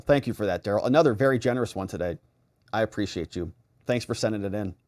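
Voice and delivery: normal talking voice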